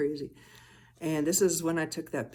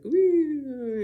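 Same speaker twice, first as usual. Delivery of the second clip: woo; 10 semitones higher, 6 dB louder than usual. Retold or imitated. Imitating the dots zooming.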